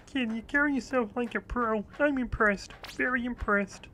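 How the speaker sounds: high puppet frog impression, approving; Kermit. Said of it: "if I can keep that voice up".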